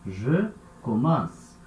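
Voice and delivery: slow, careful "Je commence"; deliberate teacher demo. Shown as a demonstration. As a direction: model it slowly for the class. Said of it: "Raiz permanece igual".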